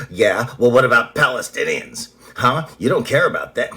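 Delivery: loud, high-pitched challenge; accusing heckler. Retold or imitated. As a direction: cut in with a pushy, indignant retort.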